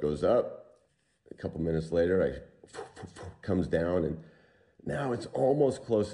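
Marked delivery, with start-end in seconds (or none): 2.8-3.4 few soft voiceless puffs; short breath-only whooshes; imitated rope noise. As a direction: mimic a falling rope with airy flutters. Imitates rope coming down once more.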